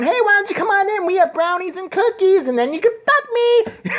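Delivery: put-on high, sing-song kid voice; hammed-up high kid voice; mock child lure. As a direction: pitch way up like a kid luring a creep. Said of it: "a little girl or a boy outside going".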